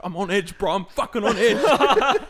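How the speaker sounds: agitated, rising to a loud drawl; retold on edge. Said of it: "He was, like, shaking".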